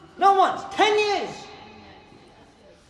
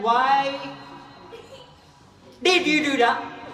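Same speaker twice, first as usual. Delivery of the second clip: scolding adult; 3 semitones higher, 5 dB louder than usual. Acted. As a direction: demand it like a cross parent.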